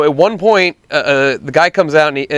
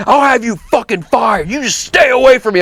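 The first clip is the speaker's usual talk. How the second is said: imitating a furious customer, higher; retold angry shout; 6 semitones higher than usual. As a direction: act out the irate man's threats. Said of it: "he starts shouting at me".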